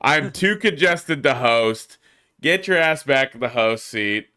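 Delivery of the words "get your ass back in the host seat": normal talking voice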